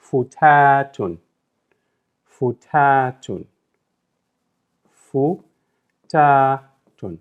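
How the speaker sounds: Futatun said slowly, nasal ending drawn out; slow model pronunciation. Shown as a demonstration. Teacher modelling a word for learners, slow and clear. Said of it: "with the double dhamma for the nasalized sound".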